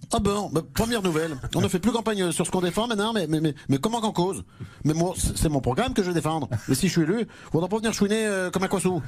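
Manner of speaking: put-on thick comic provincial French accent, stammering in indignation. Mayor protests remark on his accent, spluttering provincial French accent. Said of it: "ce joli petit accent provincial".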